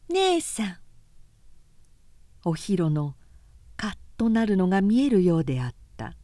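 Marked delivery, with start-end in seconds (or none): 0.1-0.8 mocking "姉さん。" pitched high and drawn out; spiteful exaggerated. Okei needling Ohiro, exaggerated spiteful call. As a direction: sneer the word, exaggerating it to needle her. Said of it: "意地悪く、誇張した声で言った".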